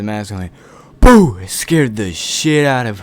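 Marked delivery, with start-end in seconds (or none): 1.0-1.4 sudden loud, high "boo"; scare shout. Retold or imitated. Masked dad jumping out at the kids, sudden shout. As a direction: burst out to make them jump.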